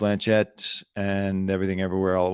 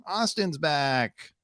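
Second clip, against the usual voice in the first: higher, put-on regular-guy voice; lighter, higher voice with a stretched ending; Austin Butler's original voice; 9 semitones higher, slower than usual. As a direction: announce the return in a high, easy mock voice. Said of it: "he should walk in like".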